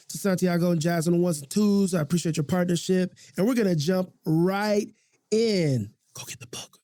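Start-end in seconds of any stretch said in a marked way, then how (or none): 6.1-6.8 breathy repeated whisper like a hidden ad; a secret message slipped in at the end of a commercial; subliminal whisper. Pretend. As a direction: whisper it under the breath, over and over. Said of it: "That was subliminal".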